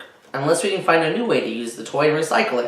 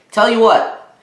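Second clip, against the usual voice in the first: put-on higher, louder bargaining boy; 7 semitones higher, 6 dB louder than usual. Spencer counters his mother's offer in a higher, louder voice. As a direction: pitch the offer like a little dealmaker. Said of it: "countered Spencer".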